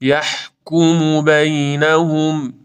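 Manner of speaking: slow chanted یحکم بینهم with husky ح; drawn-out, throaty ح in یحکم بینهم; model recitation. Shown as a demonstration. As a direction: stretch یحکم بینهم at half speed. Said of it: "ها بحه داره".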